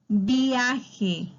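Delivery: teacher sounding out VIAJE; demonstrating a diphthong. Shown as a demonstration. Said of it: "el diptongo".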